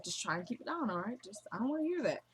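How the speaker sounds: very soft, imitating a mother's hushed plea; hushed request. Mother asking the couple to keep it down, hushed. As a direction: keep it right down, retold under the breath.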